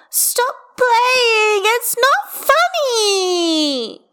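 put-on high, loud, drawn-out child's wail. A narrator's reading. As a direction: wail it in tearful protest. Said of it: "she wails".

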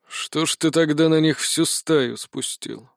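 put-on slow, creaky old man. A narrator's reading. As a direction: speak like a frail old wizard. Old Kariur speaks.

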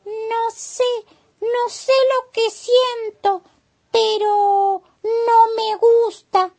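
vocecita muy aguda de caracol, sin susurrar; confiésalo lento, con voz agudísima de caracolito; caracol inquieto. A narrator's reading. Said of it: "susurró el caracol". (caracol con miedo, voz muy aguda)

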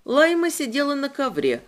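plain reading voice

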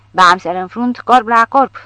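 loud, lowing bellow. A narrator's reading. The big soldier bellows that he'll fight the bear, loud. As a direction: roar it like a bull. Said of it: "mugi micuțul".

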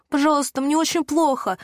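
high, urgent begging; pleading. A narrator's reading. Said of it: "взмолилась Лена".

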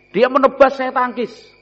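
high, excited battle narration; acted battle. Teacher dramatizing a fight with an enemy, excited. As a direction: act out the sword fight with rising energy.

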